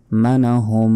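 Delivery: মানাহুম with a long hummed final m; held nasal "manahummm" for মানাহুম; nasal ghunnah. Shown as a demonstration. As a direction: hum out the end of মানাহুম. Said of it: "হুম এখানে গুন্না হবে".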